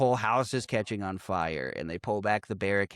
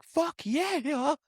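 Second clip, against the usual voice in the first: mock ecstatic cry. Quoting convulsing Craig.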